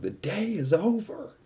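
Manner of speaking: high, slow wail of mock despair; a hammed-up lament for a lost day; dramatic mock grief. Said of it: "I was so upset".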